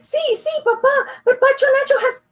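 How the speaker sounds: high, pleading, close to crying; boy fighting tears before his father; near tears. Acted. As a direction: beg with a quiver held back. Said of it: "Juan tried not to cry".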